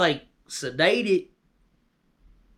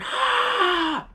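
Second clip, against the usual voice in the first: high wordless yell of a charging crowd; mock monster holler; imitated scream. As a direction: let out a sudden high "Ah!" scream. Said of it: "they hollering".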